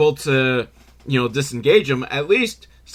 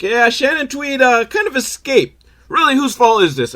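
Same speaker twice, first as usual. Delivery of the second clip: nervous henchmen; 11 semitones higher, 4 dB louder than usual. Retold or imitated. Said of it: "And they're like".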